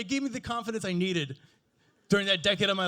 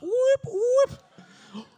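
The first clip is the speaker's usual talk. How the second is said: high, loud whoop whoop; celebratory whoop; 7 dB louder than usual. Retold or imitated. Young person showing off Photoshop to old people, high loud whoop. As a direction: cheer over the edited photo. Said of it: "lost thirty pounds using Photoshop".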